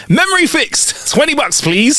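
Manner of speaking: high, chirpy announcement of a cure; triumphant. Acted. Doctor billing the tricked lawyer, high and triumphant.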